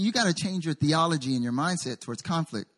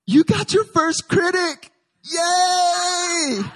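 much higher, louder, slow then a shouted yay; excited cheer; 14 semitones higher, 7 dB louder, much slower than usual. Retold or imitated. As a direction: cheer him on as if it's great news. Fellow pastor cheering his critic.

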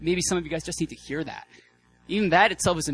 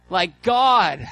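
much higher and louder impassioned sermon; fiery preaching; 7 semitones higher, 6 dB louder than usual. Acted. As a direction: proclaim it to the whole hall at full force. Preaching at full force.

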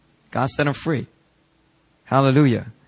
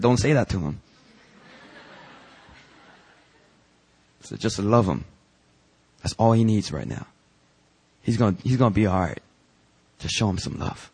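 softer and lower, tender rebuke; gentle divine voice; 4 semitones lower, 6 dB quieter than usual. Acted. Holy Spirit gently correcting the pastor, soft and tender. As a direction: speak it gently, like a calm inner voice.

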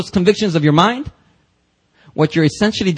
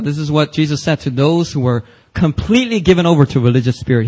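usual speaking voice

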